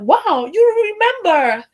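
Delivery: high, loud grateful gush; imitating a touched client; touched delight. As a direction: gush with surprised thanks. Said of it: "I just be like".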